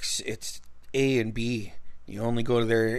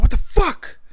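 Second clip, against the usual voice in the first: high, loud startled yell; sudden stunned shout; shocked outburst; 8 dB louder than usual. Retold or imitated. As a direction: blurt it out in disbelief. Friend suddenly runs off.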